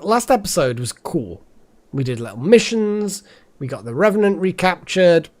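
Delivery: ordinary delivery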